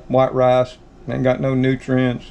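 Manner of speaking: usual speaking voice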